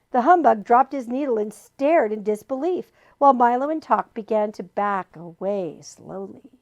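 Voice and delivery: ordinary delivery, nothing marked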